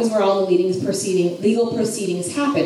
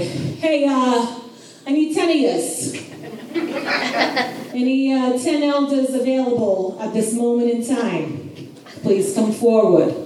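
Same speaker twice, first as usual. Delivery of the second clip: put-on New York accent; 2 semitones higher than usual. Boaz summoning ten elders, in a New York accent. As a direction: call the elders over like a hammed-up New Yorker. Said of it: "he has a New York accent".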